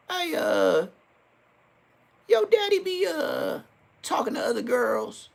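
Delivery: sweet-talking high voice, prying for gossip; high, sly coaxing voice; high mock auntie voice. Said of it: "I'm like".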